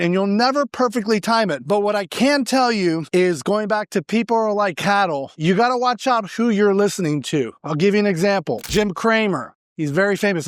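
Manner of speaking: ordinary talk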